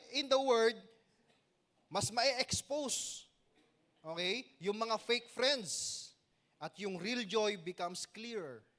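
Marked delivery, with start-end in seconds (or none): 1.9-8.7 slow emphasis. Real, not acted. Preacher drawing out key point.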